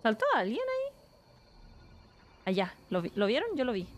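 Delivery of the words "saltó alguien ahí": much higher startled squeak. Real, not acted. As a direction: jump at a noise. Sudden alarm while hiding.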